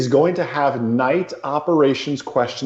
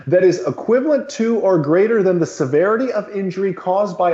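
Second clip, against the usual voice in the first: higher, formal lecturing read; professor voice; 5 semitones higher than usual. Pretend. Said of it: "Let me put on my professor voice".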